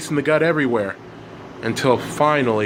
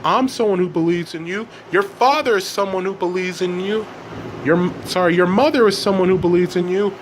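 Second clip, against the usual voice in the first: lightly voiced father, higher pitch; raised, earnest parental appeal; 6 semitones higher than usual. A narrator's reading. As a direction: reassure your child insistently. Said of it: "the father says".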